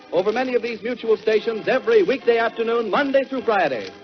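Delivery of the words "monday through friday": usual speaking voice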